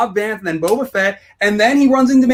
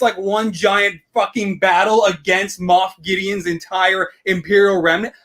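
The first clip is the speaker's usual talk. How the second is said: normal talking voice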